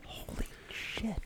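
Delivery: stunned breathless whisper; hushed in disbelief; shocked whisper. Real, not acted.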